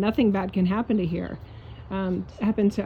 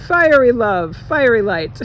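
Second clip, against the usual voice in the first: louder, punchy declaration; fiery exclamation; 2 semitones higher, 7 dB louder than usual. Real, not acted. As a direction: throw each word out with fire. Closing her rant with emphasis.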